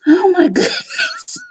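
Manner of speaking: high squealing laugh, near tears; breaking into helpless tearful laughter; crying with laughter. Real, not acted. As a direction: laugh till you cry. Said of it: "my eyes are literally full of tears from laughing".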